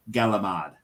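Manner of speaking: galamad with a hard g, ending clipped to MAD; galamad, Jersey Italian. Shown as a demonstration. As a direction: turn the c of calamari into a g and cut the ending. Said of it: "in this area is pronounced".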